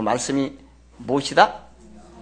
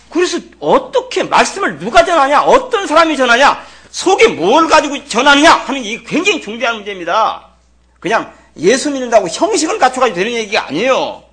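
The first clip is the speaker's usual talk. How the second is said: loud, very high impassioned preaching; urgent, raised-voice pulpit challenge; full-force preaching; 10 semitones higher, 9 dB louder than usual. Real, not acted. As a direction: fire the questions out at full voice.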